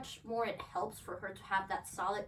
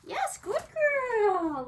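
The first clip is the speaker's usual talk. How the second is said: stretched, high, loud praise; long happy yes to the dog; slow excited praise; 12 semitones higher, 10 dB louder, much slower than usual. Real, not acted. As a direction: draw out the yes like a reward.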